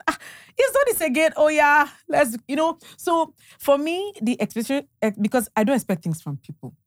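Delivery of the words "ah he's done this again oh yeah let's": exasperated quote. Retold or imitated. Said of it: "you're like".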